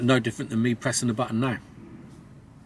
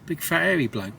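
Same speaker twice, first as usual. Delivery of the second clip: normal talking voice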